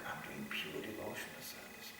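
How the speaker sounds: whispered pious claim; mock whisper. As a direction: mutter it smugly under the breath.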